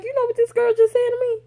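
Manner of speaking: high, fast, loud disbelief; scandalized outburst. Retold or imitated. Telling her boss about the employee, scandalized. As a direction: rush it out in a high squeal. Reporting outrage to boss, retold.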